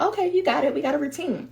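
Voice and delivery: lightly acted high voice giving in; a hint of pacified little voice; appeased inner voice. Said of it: "the quieter it becomes".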